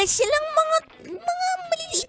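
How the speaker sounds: tiny voices. Retold or imitated. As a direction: imitate a couple talking in tiny voices. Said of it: "maliliit yung boses".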